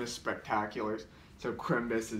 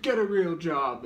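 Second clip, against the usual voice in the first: higher, louder mocking jab; brother's voice; 7 semitones higher, 7 dB louder than usual. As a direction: call out the brother's line, pitched up and loud. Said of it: "I did the voice of the brother".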